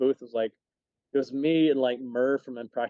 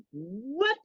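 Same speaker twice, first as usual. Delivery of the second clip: stunned what; 12 semitones higher than usual. Retold or imitated. Stunned reaction to meeting celebrity.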